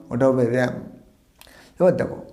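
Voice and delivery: ordinary talk, nothing marked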